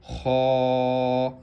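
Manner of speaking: heavy خو from the throat. Shown as a demonstration. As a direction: give خو a thick, heavy sound. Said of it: "اس کو ہم موٹا کریں گے".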